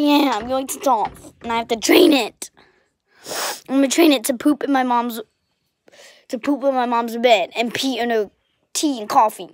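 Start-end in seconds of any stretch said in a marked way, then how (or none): none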